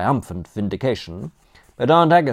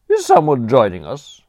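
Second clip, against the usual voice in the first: sniffy; 5 semitones higher, 8 dB louder than usual. A narrator's reading. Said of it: "Aunt Agatha sniffed".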